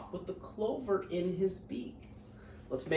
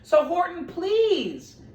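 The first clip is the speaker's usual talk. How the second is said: pleading; 9 semitones higher, 13 dB louder, much slower than usual. Acted. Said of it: "pleaded the voice".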